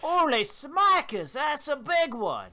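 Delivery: high, loud, slow mock-Australian cry; Australian accent. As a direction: ham up an amazed Australian woman. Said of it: "She's Australian".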